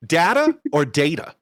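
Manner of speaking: "data" first as TAH-ta then DAY-ta; two data pronunciations. Shown as a demonstration. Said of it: "Is it because the T is sharp".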